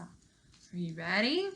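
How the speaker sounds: ordinary delivery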